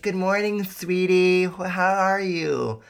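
high, sweet morning greeting; lightly voiced sugary wife. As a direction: coo it like a loving wife. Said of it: "she's like".

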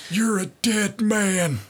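slow, high raspy wrestler growl; Terry Funk voice. Retold or imitated. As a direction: drag it out like an old wrestler. Terry Funk impression, quoted line.

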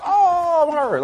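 high, drawn-out fake "oh" of injury; hammed-up wounded whine; mock hurt. Sarcastically acting wounded by a slur.

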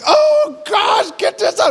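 very high, strained imitation of a wife in labor; mock labor scream. Preacher imitating his wife in labor, mock agonized scream. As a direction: scream it as if mid-contraction, hammed up.

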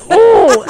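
loud high yelp of pain; sudden yelp, loud and high. Retold or imitated. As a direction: yelp like a sticker ripped off hair. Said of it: "And I'm like".

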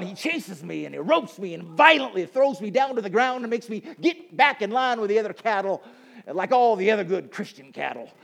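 loud, high, hammed-up cowboy accent; over-the-top cowboy accent at full volume. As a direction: holler it like a cowboy preacher. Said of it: "My cowboy accent".

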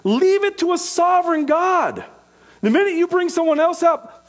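high-pitched, impassioned sermon delivery; forceful preaching. Real, not acted. Preacher urging the church to seek repentance, forceful preaching.

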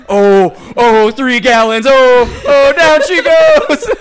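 mock moaning. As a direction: moan it out, building up. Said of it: "just jerking off".

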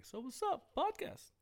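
high, put-on teasing voice; mock friend greeting. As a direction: tease him like a buddy would. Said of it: "be like".

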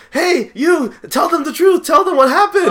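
hammed-up plea from the gallows; mock desperate woman. Condemned woman calls from gallows.

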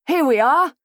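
a gentleman cheerfully shouting from an overturned carriage; cheerful cry. A narrator's reading. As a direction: cheer everyone up from the wreck. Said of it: "he cried with admirable cheer".

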